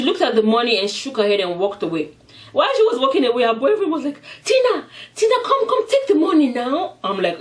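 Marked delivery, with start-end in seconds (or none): 4.4-6.9 high, comic pleading call; urgent calling after. Retold or imitated. Boyfriend calls Tina back to the money, high mock urgency. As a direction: call her back in a hammed-up squeal.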